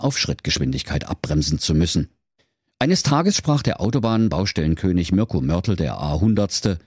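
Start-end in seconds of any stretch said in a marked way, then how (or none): none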